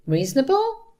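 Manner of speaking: high, rising disbelief; indignant questioning repeat; incredulous echo. A narrator's reading. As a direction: throw the word back as a question.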